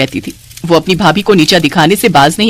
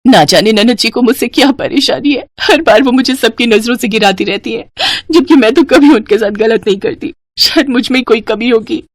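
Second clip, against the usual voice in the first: sobbing self-doubt, acted; weepy, wronged daughter-in-law; tearful; 3 semitones higher than usual. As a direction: think aloud through tears. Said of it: "खूब रोती है और मन ही मन सोचती है".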